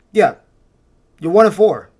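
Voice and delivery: mocking echo, "one" stressed; sarcastic retort. Real, not acted. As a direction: throw the coach's words back at him in disbelief.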